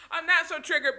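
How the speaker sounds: amused self-mocking repeat; laughing. Real, not acted. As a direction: laugh through the words at yourself. Repeats phrase, then laughs.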